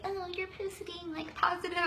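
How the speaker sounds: high, sneering mock of a coworker; a hint of a snide coworker teasing. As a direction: lightly imitate a coworker making fun. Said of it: "make fun of me and say".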